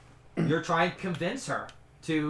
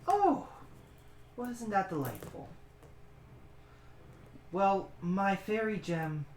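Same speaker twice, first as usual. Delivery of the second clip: airy fey woman; 3 semitones higher, 4 dB quieter than usual. Acted.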